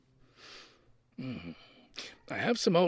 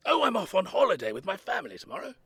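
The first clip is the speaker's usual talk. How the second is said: ordinary talk, nothing marked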